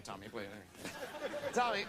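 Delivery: normal talking voice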